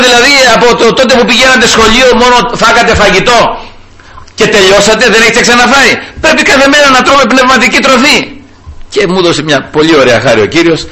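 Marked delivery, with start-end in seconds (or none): 0.1-8.2 heated preaching. Retold or imitated. Challenging passengers about reading the Gospel, fast and heated. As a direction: press them hard, rapid fire.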